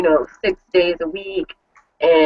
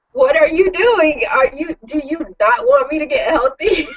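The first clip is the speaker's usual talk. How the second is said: mock outraged disbelief; 8 semitones higher than usual. Scolding husband for bringing Olive Garden, incredulous. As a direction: throw the question back at him, half laughing. Confronting husband over takeout, escalating.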